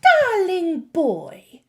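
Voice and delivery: high, slow, sugary witch croon; cooing witch. A narrator's reading. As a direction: coo it sweetly like a scheming witch. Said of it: "cooed the Grand High Witch".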